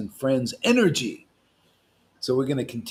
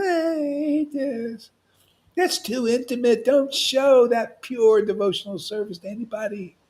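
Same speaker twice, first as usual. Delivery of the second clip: higher, prissy objecting voice; mocking critics; 9 semitones higher than usual. Preacher mimicking people who object to public ecstasy. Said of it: "people are like".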